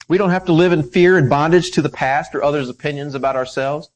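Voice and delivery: loud, emphatic preaching; preaching at full force. Acted. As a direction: proclaim it to the whole church.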